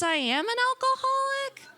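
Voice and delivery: very high, hesitant voice; reluctant mock admission. Newcomer changing their answer for AA members, high-pitched unsure admission. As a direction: squeak it out as an unconvinced confession.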